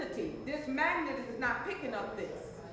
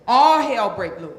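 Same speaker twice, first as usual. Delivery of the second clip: loud, emphatic chant line; loud call-and-response; 12 dB louder than usual. Acted. Coach leading the audience, chanting it loud. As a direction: belt it out for the crowd to echo. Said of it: "say it with me".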